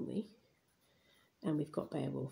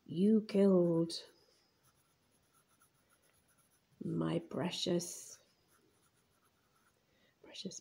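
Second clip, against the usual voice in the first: slow, stretched words; slow writing-along voice with long gaps; drawn-out dictation; slower than usual. Real, not acted. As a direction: draw it out while writing. Writing hag's reply aloud.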